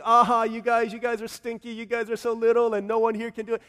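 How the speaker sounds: mock bully giant, high and loud; taunting giant. Giant taunting the church people, mock jeer. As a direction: jeer at them like a big bully. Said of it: "taunting your people".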